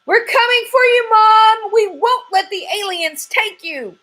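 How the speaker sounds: high screaming charge; battle cry. Acted. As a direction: rush to the rescue yelling. Chicken charging to rescue.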